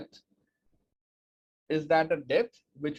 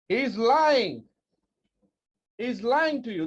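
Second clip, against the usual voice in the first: raised, punchy warning; dramatic accusation; 7 semitones higher, 5 dB louder, slower than usual. Acted. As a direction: call it out like a secret exposed. Opening hook, dramatic accusation.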